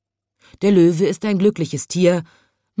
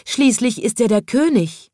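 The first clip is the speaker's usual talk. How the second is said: high, emphatic storybook cry; wildcat crying out; 7 semitones higher, 4 dB louder than usual. A narrator's reading. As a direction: plead the lion's case in a raised voice. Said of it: "schrie plötzlich die bunte Wildkatze". (hoch rufende Wildkatze)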